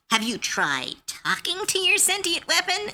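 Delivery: high, pointed sarcastic question; sarcastic reply. Retold or imitated. DM teasing a player about his sentient weapon, mock-innocent.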